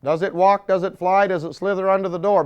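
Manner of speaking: rising mock-incredulous questions. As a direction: poke fun at the idea. Mocking questions about the it.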